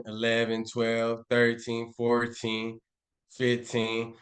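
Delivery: ordinary talk, nothing marked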